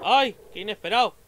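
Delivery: jumpy exclamation; startled cry. Real, not acted. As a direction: caught off guard. Sudden jump scare.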